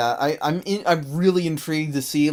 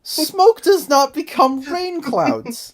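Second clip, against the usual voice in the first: high, loud, slow protest; exasperated protest; 11 semitones higher, 5 dB louder, much slower than usual. Real, not acted. Host correcting co-hosts on cartoon physics, exasperated protest. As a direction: spell it out at the top of the voice.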